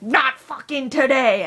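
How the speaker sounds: slow, loud "Not fucking today!" shouted out; defiant yell. Shown as a demonstration. Vlogger showing viewers how to scream off a dark mood. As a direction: yell it like a battle cry. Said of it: "start screaming and be like".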